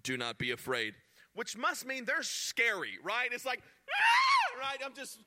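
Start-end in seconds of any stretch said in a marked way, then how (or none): 0.0-0.9 lower, booming angel warning; lightly voiced angel; mock angel voice. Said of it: "the first thing an angel says". 3.9-4.6 high, loud startled mock shriek; hammed-up angel scream. Imitating a terrifying angel.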